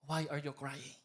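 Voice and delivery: hushed, caring question; tender divine question. Acted. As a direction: voice God's question quietly, letting the pitch drop. Voicing God's question to the congregation.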